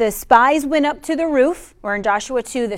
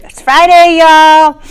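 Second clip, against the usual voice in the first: excited shout; 5 semitones higher, 12 dB louder, slower than usual. Real, not acted. Hyping the crowd for Friday.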